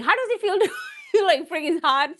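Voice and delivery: high, fast, laughing through the words. Real, not acted. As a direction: giggle while asking. Laughing about the heat.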